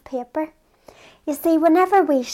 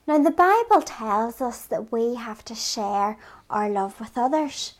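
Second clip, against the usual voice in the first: ordinary talk, nothing marked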